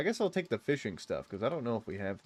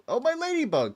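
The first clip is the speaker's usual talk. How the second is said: much higher, louder squeal of joy; light up at the find; delighted surprise; 14 semitones higher, 5 dB louder than usual. Real, not acted. Finds ladybug in game.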